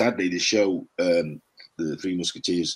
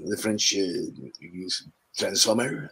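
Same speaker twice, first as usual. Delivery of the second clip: French accent; 4 dB quieter, much slower than usual. Shown as a demonstration. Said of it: "turn up as a French one".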